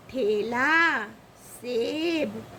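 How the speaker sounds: ठेला and सेब with the e held long. Shown as a demonstration. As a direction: stretch the vowels of ठेला and सेब. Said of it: "इसको कैसे pronounce करते हैं?".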